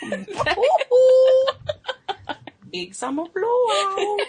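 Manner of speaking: very high, laughing, slow shout; Frozen shopkeeper impression. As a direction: belt it like a cheery shopkeeper. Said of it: "From Frozen".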